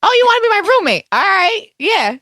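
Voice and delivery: high confused rattle with a fast burst; Teresa Giudice. Retold or imitated. Teresa confused by roommate talk, high Jersey voice. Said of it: "Teresa's like".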